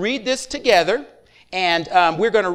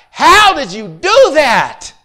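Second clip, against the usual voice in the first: slow, much louder, higher astonished question; amazed; 4 semitones higher, 8 dB louder, much slower than usual. Retold or imitated. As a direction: stretch it out in disbelief.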